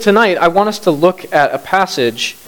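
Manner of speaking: ordinary talk, nothing marked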